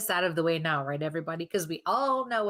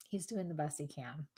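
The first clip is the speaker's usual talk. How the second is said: lightly hushed, much softer voice; softly relayed quote; 10 dB quieter than usual. Retold or imitated. She repeats what the spirit says of him, softly.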